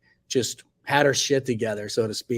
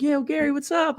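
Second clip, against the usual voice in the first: friendly greeting voice; 13 semitones higher than usual. Retold or imitated. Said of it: "So he was like".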